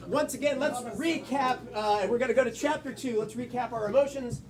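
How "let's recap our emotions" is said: ordinary delivery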